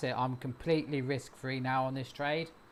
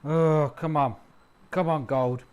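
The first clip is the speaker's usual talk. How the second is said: urging on; 2 semitones higher, 10 dB louder than usual. Real, not acted. Urging gold price up.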